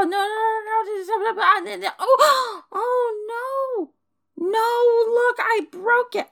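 very high, frantic dismay; panicked wail. Acted. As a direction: wail in horror at the damage. Knocks over and breaks plant.

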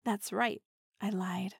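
intimate teasing whisper; close, breathy-free hushed tease. Acted. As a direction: lean in and whisper it.

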